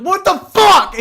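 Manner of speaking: high, loud exclamation; shocked outburst. Retold or imitated. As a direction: blurt it out in disbelief. Dog knocked the plate off.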